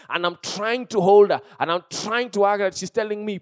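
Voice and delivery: usual speaking voice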